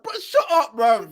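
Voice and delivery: high, loud mock telling-off; exasperated outburst. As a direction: burst out at a cringy poster. Acted annoyed reaction to football accounts.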